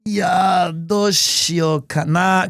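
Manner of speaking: slow Japanese self-talk, "Ya, doshio kana" said as in Japanese; drawn out Japanese musing to himself; Japanese muttering. Real, not acted. Confused at the tents, thinks aloud in Japanese. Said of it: "'cause I'm still in J P mode".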